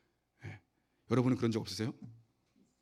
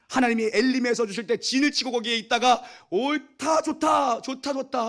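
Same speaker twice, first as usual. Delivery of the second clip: high, loud preaching cry; fervent preaching; 8 semitones higher, 4 dB louder than usual. Acted. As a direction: preach out the people's fickle joy. Preaching at full force.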